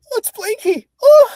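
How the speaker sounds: a fan overjoyed to recognise the voice; thrilled recognition. Retold or imitated. Fan telling the voice actor how hearing Blinky feels, thrilled high squeal. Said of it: "it's just nostalgic enough to be like".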